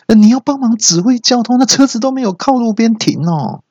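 high, sustained mock scolding; comic outraged yell on a high note; angry scolding. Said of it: "那经理就大骂说".

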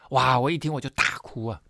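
ordinary delivery

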